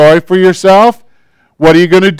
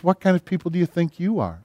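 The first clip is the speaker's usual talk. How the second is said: much softer, hushed voice of God; whisper; 13 dB quieter than usual. Retold or imitated. God gently rebuking the complainer, hushed and soft. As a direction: drop to a hushed, gentle question. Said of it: "God begins to whisper and says".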